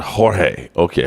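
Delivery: normal talking voice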